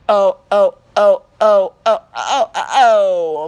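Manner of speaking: loud repeated oh oh with a laugh; loud oh oh shouts. Acted. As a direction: belt out oh oh. Loud exclaiming.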